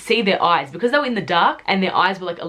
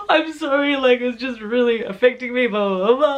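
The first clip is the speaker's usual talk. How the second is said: higher, tearful mock-sobbing apology; retold tearful voice, pitched up; imitating own crying; 5 semitones higher than usual. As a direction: replay the teary apology through sobs. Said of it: "I was just so upset".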